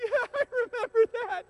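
mock laughing reply. Friends reminiscing, laughing at memory.

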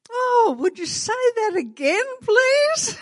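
high, bright-eyed gushing shop assistant; charmed shop girl. Retold or imitated. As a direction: gush like a delighted cashier hearing a new accent. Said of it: "And the girl on the counter said".

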